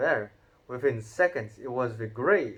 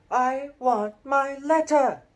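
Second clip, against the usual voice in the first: high, loud, slow shout; 14 semitones higher, 9 dB louder, slower than usual. A narrator's reading. Harry shouts for his letter at his uncle, high slow shout. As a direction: shout it out, drawn long. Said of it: "he shouted".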